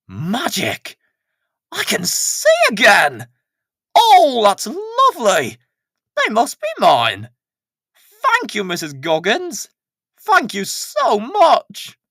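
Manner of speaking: delighted Pat. Acted. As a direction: gush with relief at seeing again. Pat delighted to see again.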